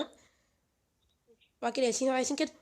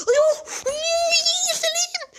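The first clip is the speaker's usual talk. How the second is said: very high, loud squealed exclamations; excited squeal; 7 dB louder, slower than usual. Real, not acted. Player reacting to someone hitting him in game, excited squeal. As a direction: squeal it out far above your usual pitch. Startled squeals during gameplay.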